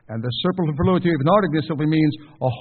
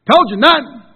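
shouting, high and loud; angry outburst; 10 semitones higher, 10 dB louder than usual. Acted. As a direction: blow up at them. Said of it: "you blow up like this".

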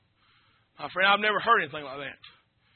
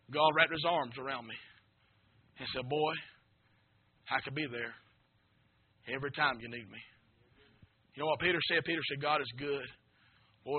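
hushed tender; 6 semitones lower, 8 dB quieter than usual. Acted. Pastor tenderly telling the congregation God holds him. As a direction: bring the room down to a near hush.

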